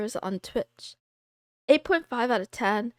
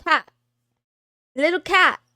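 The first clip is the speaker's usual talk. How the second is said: louder, higher sing-song mom calling her cat; mom's voice; 4 semitones higher, 8 dB louder than usual. Retold or imitated. Mom telling her cat-daughter about snacks, loud and sing-song. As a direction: call her like a mom with good news. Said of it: "and she was like".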